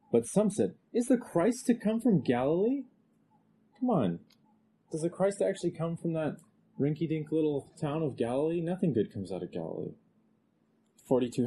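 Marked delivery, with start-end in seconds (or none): 0.9-9.9 mock the idea as absurd; sneering at the rinky-dink town; scoffing disbelief. Voicing scoffers dismissing Galilee.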